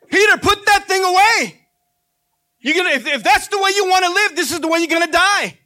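a firm command to put the sword away; Jesus rebuking Peter. Retold or imitated. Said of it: "It's what Jesus is telling us".

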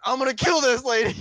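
high, loud mock threat; mock road rage scream. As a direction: yell it from the driver's seat.

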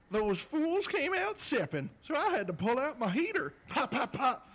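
hammed-up street tough voice; high, slow gangster act; mock urban accent. Reading card in an urban accent.

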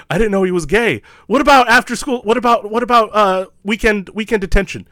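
high, loud imitation of a panicked principal; frantic backpedalling. Imitated principal calls after the family threatening to sue, frantic backpedalling. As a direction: call after them, scrambling for a compromise.

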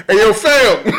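calling out. Acted. Upstairs neighbor hollering over about the smell, calling out. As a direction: call out to someone in the next flat.

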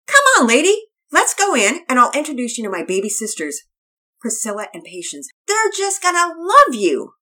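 put-on an excited girl welcoming the dog; little girl Penelope.

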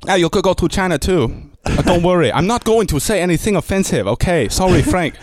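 high mock Chinese accent; rough attempt at a Chinese accent; chirpy put-on Chinese accent. Shown as a demonstration. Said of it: "Probably not even a Chinese accent".

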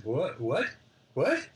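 mock bafflement. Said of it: "And I went".

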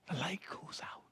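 la lumière s'éteint, dit tout bas, dans un murmure; hushed whisper. Real, not acted. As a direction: let it fall to a bare whisper. Preacher drops to a hush.

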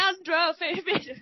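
hammed-up Spanish accent on the name; rolling out Leandro Firmino with flair. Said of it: "my Spanish accents".

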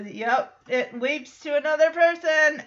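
ordinary talk

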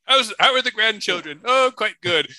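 put-on high, chatty old man voice; a sweet old man up high, offering a gift; elderly friend. Old man reply in skit.

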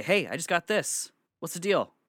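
high, urgent question to grandma. Retold or imitated. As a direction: ask it high and pressing. Confronting grandma about the text.

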